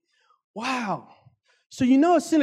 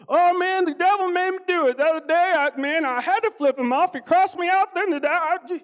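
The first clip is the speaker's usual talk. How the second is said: mock excuse; 7 semitones higher than usual. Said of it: "We go".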